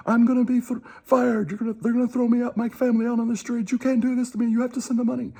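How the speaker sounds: high-pitched mock begging voice; hammed-up whine to guilt the caller; pleading scammer. Scammer begs the caller to send the money, high mock plea.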